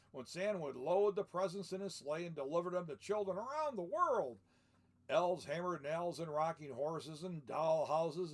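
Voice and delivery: lively read-aloud storybook voice; story narration. A narrator's reading. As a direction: read it like a picture book to children. Reads the book aloud.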